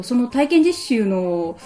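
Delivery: normal talking voice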